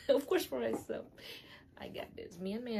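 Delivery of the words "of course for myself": laughing. Real, not acted. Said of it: "Not for myself".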